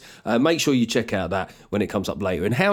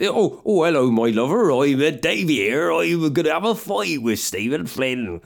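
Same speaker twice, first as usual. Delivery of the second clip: put-on broad, comic West Country yokel accent; invented West Country accent; 3 semitones higher than usual. Inventing Ed Davey's voice, broad West Country accent. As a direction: lay on a rolling West Country burr. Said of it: "I could just pick an accent".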